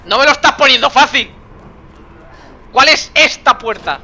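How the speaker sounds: loud, high complaint; exasperated calling. Real, not acted. Player to unseen character behind a door, exasperated shouting. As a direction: shout back, fed up with vague orders.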